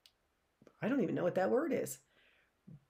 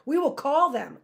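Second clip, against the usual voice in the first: firm and louder, trying to sound brave; 7 semitones higher, 6 dB louder than usual. Acted. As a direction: say it firmly despite fear. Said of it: "in what he hoped was a firm tone of voice".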